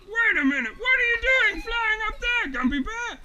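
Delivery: loud, high, incredulous bear; astonished shouting. Acted. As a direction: holler up in disbelief at a friend. Honey Bear astonished, calling up.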